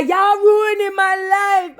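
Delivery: high, slow hammed-up wail; mock wail. Zane whining, voiced.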